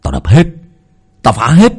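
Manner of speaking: half-mad boast after the wrecking; crazed gloating. Acted. Hảo standing over the smashed shrine, gloating a little manically. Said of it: "miệng cười hình hạch như là kẻ điên".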